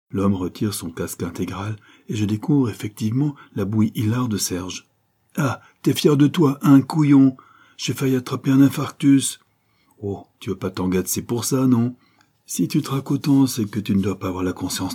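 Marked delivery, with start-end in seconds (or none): 5.4-9.4 higher, louder startled reproach; indignant scolding. A narrator's reading. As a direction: tell him off after the fright. Narrator startled, scolds Serge. (reproche indigné, plus aigu et plus fort)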